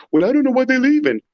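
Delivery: high, whiny mock of a puzzled woman; mocking women. Man mocking women left behind, high and whiny. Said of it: "you're saying".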